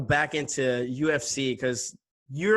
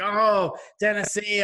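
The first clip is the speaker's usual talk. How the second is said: louder, higher jeering imitation; mocking fans; 6 semitones higher, 5 dB louder, slower than usual. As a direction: imitate hecklers teasing loudly. Mimicking fans giving him grief.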